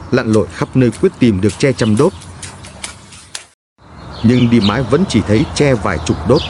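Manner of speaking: usual speaking voice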